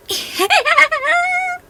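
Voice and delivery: high squealing giggle; fairy squealing over romance; giddy squeal. Acted. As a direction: squeal with delight.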